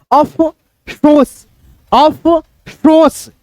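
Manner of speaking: अफसोस said very slowly, फ pushed through the nose; nasal फ demo. Shown as a demonstration. Teacher showing how to say फ in अफसोस, slowly. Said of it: "फ को जो है ना नाक से बोलो".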